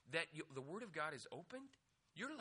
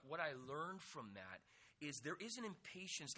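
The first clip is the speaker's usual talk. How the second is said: ordinary talk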